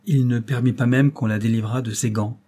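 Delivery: ordinary delivery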